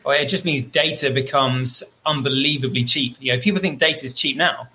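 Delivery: ordinary talk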